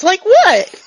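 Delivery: high, loud, puzzled outburst; eager, clueless character voice; oblivious character question. Acted. Oblivious character asks what she means.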